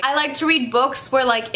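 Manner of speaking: ordinary delivery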